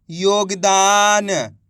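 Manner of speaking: very drawn-out model word; slow blended reading. Shown as a demonstration. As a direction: stretch every syllable while joining them into one word. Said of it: "इनको आपस में मिला के पढ़ना है". (अक्षर मिलाकर खूब खींचकर पढ़ना)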